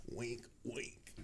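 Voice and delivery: hushed, knowing aside; sly aside. Real, not acted. Streamer hinting to viewers about range tests, hushed and sly. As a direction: drop the volume right down for the hint.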